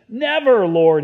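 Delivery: shocked disciple objecting loudly; emphatic protest. Acted. Acts out Peter rebuking Jesus.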